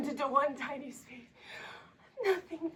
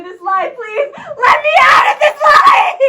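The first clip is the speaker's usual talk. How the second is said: sobbing shouts, much higher and louder; screaming, crying; 8 semitones higher, 15 dB louder than usual. Acted. Girl screaming at the men dragging her away, crying. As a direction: scream and sob to be let go.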